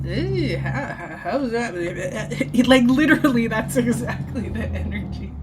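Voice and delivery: giggling through the words; laughing. Real, not acted. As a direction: laugh while asking. Laughing at the awkwardness.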